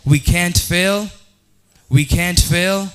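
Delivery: fervent, slow, loud preacher cadence; rising pulpit declaration; preaching. Acted. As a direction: preach it to the crowd. Preaching at full force.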